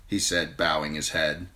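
usual speaking voice

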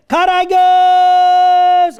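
very long, high-pitched holler; wake-up shout. Acted. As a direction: stretch the name in a full yell. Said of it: "Karagöz diye bağırıp uyandıralım". (uzun, tiz bağırış)

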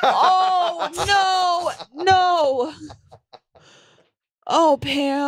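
high, drawn-out mock wail; mock mourning. Friend pretending to mourn Pam, hammed-up lament. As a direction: moan it high in hammed-up grief.

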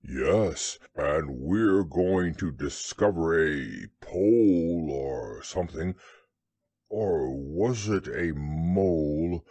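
low, slow bear voice; deep bear working it out slowly; muddled Pooh. A narrator's reading. Bear explains the expedition to Rabbit, low and slow.

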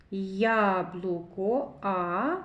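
slow "Яблуко", opening А stretched; sounding out. Shown as a demonstration. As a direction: draw out "Яблуко", then isolate "А". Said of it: "зробимо звуковий аналіз слова яблуко".

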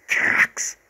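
ordinary delivery

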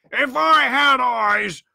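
high, slow lament; anguished cry. A narrator's reading. Blind man laments his blindness, crying out slowly. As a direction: cry out in bitter frustration. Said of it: "he cried".

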